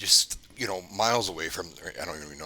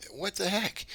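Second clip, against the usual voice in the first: mock-startled, pitched way up; retold bafflement; 14 semitones higher, slower than usual. Wife hears voice from camera, imitated high-pitched surprise. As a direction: imitate a puzzled reaction, high without extra volume.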